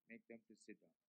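quiet authority, no hurry; God's calm command. Retold or imitated. God's calm answer, contrasted.

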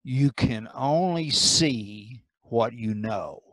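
slow, low, measured preaching; solemn sermon maxim. Real, not acted. Preacher closing on knowledge of God, slow solemn delivery. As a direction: deliver it slowly and weightily, with pauses between phrases.

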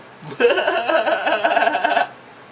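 shrieking high laugh; loud high laugh. Real, not acted. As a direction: burst out in a high cackle.

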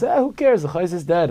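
high, loud scoffing "Eh, who cares?" then settling down; the old man mimics the brash boy with a scoff; mocking retold retort. Old man retelling boy's retort.

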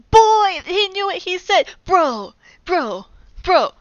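sharp gasp then high loud exclaims; gasp, then call out amazed; gasping astonishment. Real, not acted. Reader amazed at the serpent's words, gasping loudly.

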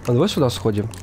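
usual speaking voice

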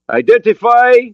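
put-on high, loud accented demand; accented challenge shout. Borodin challenging the approaching raft, accented shout. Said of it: "He had an accent, but his English was understandable".

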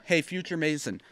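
ordinary talk

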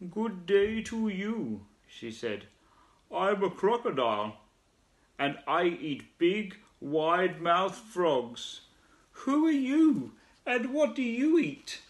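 slow, high menacing crocodile; sly crocodile voice. Acted. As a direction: drawl it out like a lazy crocodile. Crocodile with lazy smile.

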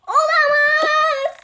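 high, slow mock-dramatic "Olamaz"; dramatic gasp. To the family, just for the drama, hammed-up dismay. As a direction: gasp it like a soap heroine. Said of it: "Sadece olamaz demek için söylemiştim".

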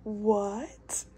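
breathless whisper of disbelief; whispered shock. Real, not acted. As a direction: gasp it out in a whisper. Shocked by Bachelor twist.

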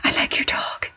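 soft whispered flirting; whispered flirt to a hot dog walker. Pretend. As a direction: whisper it shyly to him. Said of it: "I'm gonna be like".

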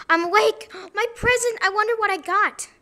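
high, loud, eager burst; excited waking. Pretend. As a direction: gasp and pounce on the present. Character wakes to find a present.

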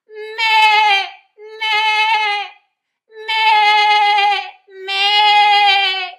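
loud, high twangy sheep "meh"; sheep bleat. Shown as a demonstration. Singing teacher leads twang practice, loud exaggerated sheep bleats. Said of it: "the sound of a sheep".

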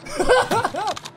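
high-pitched gloating cackle; gloating laugh. Real, not acted. Gamer laughing after shouting his taunt, gloating laugh. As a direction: laugh high over a beaten opponent.

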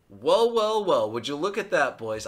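high, loud, sing-song gloat. Real, not acted. Streamer showing off the win to his chat, gloating loudly.

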